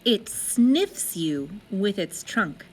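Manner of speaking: usual speaking voice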